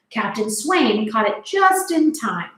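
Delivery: plain reading voice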